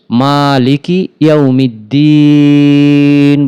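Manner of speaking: মালিকি ইয়াউমিদ্দিন chanted slowly, the long vowel before দ্দিন stretched; drawn-out Quran recitation. Shown as a demonstration. Teacher recites the verse for students, slow tajweed chant. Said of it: "তিন আলিফ টেনে নুনকে সাকিন করে".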